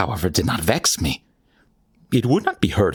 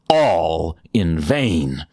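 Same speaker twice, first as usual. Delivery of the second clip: slow, heavy emphasis; 2 semitones lower, much slower than usual. Acted. Repeated phrase, drawn out.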